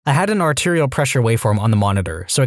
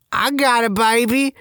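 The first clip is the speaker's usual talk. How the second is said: mock [name] accent, pitched way up; 11 semitones higher, slower than usual. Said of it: "Think [name] type accent".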